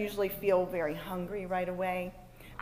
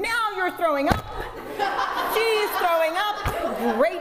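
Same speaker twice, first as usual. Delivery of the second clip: high, loud exasperated outburst; acted-out yell of a mother at wit's end; re-enacted yelling; 9 semitones higher, 8 dB louder than usual. Mother as child pukes in car, exasperated yelling. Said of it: "before I started yelling".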